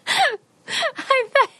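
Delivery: high squealing laugh through words; laughing hard. Real, not acted. Cohost cracking up at the catchphrase, laughing high.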